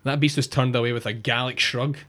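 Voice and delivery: ordinary talk, nothing marked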